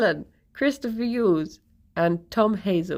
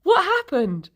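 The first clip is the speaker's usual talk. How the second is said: confused friend; 10 semitones higher than usual. Retold or imitated. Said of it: "He then picks it up and goes".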